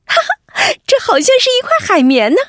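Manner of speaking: high, fast gleeful find; thrilled at a lucky find; delighted. Acted. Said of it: "菠菜的心里高兴极了". (惊喜地快速说)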